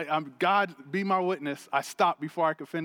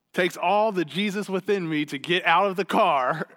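ordinary talk, nothing marked

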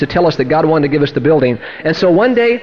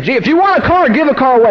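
raised-pitch pulpit proclamation; preaching; 6 semitones higher than usual. Retold or imitated. Preacher delivers his car sermon that night, preaching. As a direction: proclaim it high to the congregation. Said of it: "I'm out there preaching that night".

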